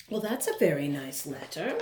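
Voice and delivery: usual speaking voice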